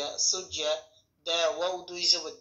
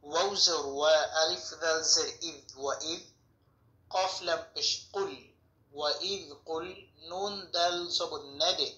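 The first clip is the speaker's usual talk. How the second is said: ordinary talk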